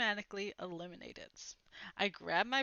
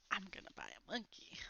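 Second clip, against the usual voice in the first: excited secret whisper; hushed, gleeful whisper of a wish; 10 dB quieter than usual. A narrator's reading. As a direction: whisper it like a kid's big plan. Kid dreaming of prize money.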